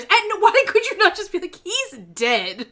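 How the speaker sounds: loud, high exasperated rant. Real, not acted. Host fed up with a character's evasive dialogue, exasperated. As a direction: burst out in disbelief, loud.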